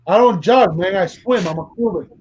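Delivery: put-on Tony Montana refuses Gump's jog, Cuban accent. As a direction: brush him off like Scarface. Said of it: "Tony Montana meets Forrest Gump".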